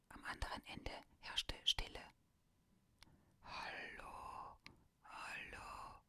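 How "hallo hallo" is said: faint, rasping, breathless whisper; a dying voice barely getting the words out; weak wheezing caller. Acted. Fake caller pranks Dominik, weak wheezing voice. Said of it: "schwach und sehr tief ... röchelnd und keuchend".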